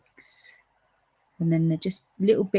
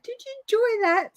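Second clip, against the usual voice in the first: very high-pitched, laughing retold question; squeaky with suppressed giggles; giggly teasing question. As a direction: ask it while barely holding in laughter. Retelling a joke while giggling.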